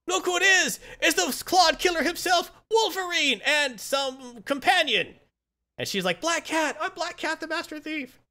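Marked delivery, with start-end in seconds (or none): 0.1-5.2 high, drawn-out fight announcer voice; hammed-up announcer. Arena host hailing Wolverine and his companion, hammed-up announcing. Crowd greets arriving Wolverine. 6.2-8.2 high-pitched retort, tailing softer; mock indignant announcement. She corrects the arena crowd.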